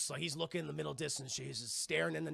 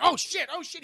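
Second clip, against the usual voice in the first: loud, high, mock panic; hammed-up freak-out; panicked ape; 11 semitones higher, 10 dB louder than usual. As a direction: yelp it in alarm.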